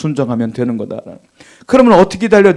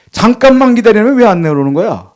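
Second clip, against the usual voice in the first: retold annoyance, pitched higher; mock a husband losing patience, raised voice; angry husband; 5 semitones higher, 4 dB louder than usual. Husband demands to know why his wife hasn't come down, angry. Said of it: "남편이 화가 났어요".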